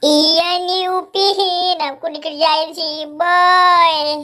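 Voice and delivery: high, drawn-out pretend sobbing whine; tearful whining. Po telling Upin about the prank, tearful whining. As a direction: whine through mock tears. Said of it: "Jangan nangis".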